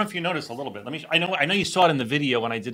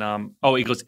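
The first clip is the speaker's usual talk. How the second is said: normal talking voice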